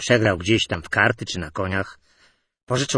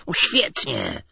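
pleased; 5 semitones higher, much slower than usual. A narrator's reading. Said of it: "ucieszył się dziennikarz".

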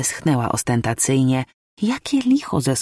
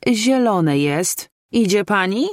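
slow, sharp, curt neighbour; mild stern thunder, slowed; sharp boom; 7 semitones higher, slower than usual. A narrator's reading. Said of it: "zagrzmiała ostro pani Danuta".

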